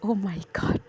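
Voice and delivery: ordinary delivery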